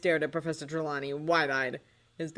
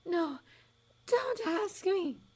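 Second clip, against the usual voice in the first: high, slow, dreamy prophetess; a high airy fortune-teller sizing up a doubter; misty seer voice; much slower than usual. A narrator's reading. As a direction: float it like a mystic seer. Trelawney refuses.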